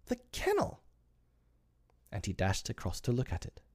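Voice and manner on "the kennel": put-on very high excited boy's cry; John. A narrator's reading. John spotting the kennel, excited. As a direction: squeal the discovery. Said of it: "cried John".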